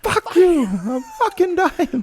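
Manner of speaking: voicing the wounded cop's outburst; mock agonized yell. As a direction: yell it through the pain. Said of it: "It's, it's like".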